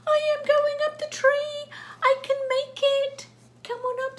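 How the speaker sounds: very high, loud squeal; excited climbing animal. Acted. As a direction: cry out high, urging up the tree. Cat climbs, bird calls.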